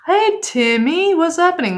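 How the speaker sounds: high, loud, drawn-out hello; excited greeting. Real, not acted. Streamer hailing a viewer who just turned up, excited and high. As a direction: sing out a delighted greeting.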